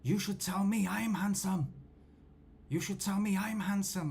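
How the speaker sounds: high, indignant insistence, pitch pushed up; mock an annoyed stranger demanding a compliment; angry demand. Young guy at a corner angrily demands to be called handsome, high mock insistence. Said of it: "The guy started getting angry".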